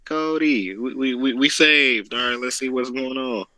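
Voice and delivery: mock-hyped fan ending in a high woo; excited fan reaction. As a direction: act out cheering the challenge. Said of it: "I'm like".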